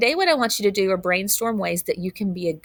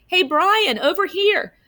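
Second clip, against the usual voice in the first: high, bright call; 10 semitones higher than usual. Acted. Justin calling Brian to his table, high and bright. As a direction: wave him over with your voice.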